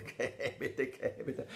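giggling through the words; a boy who can't stop laughing at his prank. Acted. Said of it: "non la smetteva più di ridere". (detto ridendo a crepapelle)

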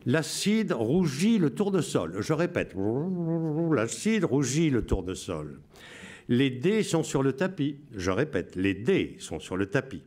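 solemn Radio Londres announcer. Shown as a demonstration. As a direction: read the coded messages like a BBC broadcaster. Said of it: "Je vous lis avec la voix".

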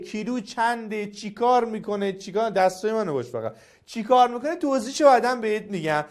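ordinary talk, nothing marked

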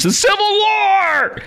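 very high, drawn-out hammed-up holler; mock alarmed cry. Host mocking a pundit's dire warning, high exaggerated yell. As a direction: blow it up into a huge slow cry.

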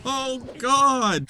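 high, squealed words breaking into laughter; high-pitched laughing cry; laughing outburst. Real, not acted. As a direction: crack up while exclaiming. Laughing outburst mid-fight.

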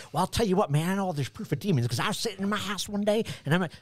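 high-pitched, rambling Southern storyteller; mock Southern accent. Man telling lecturer his proof of demons, mock Southern accent. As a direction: spin the yarn in a put-on Southern accent. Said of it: "with an accent like that".

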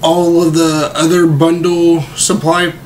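ordinary talk, nothing marked

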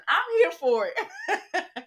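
high and slow through a laugh; laughing delight. Real, not acted. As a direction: cheer it, half laughing. Gleeful reaction to soap drama.

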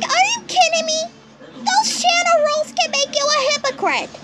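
ordinary talk, nothing marked

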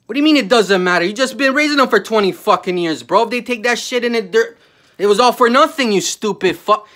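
loud, high angry reply; loud angry retort at a dismissive parent. Real, not acted. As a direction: snap back in real anger.

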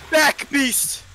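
high, loud battle yell; shouting. Real, not acted. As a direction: scream at the beast. Yelling at monster.

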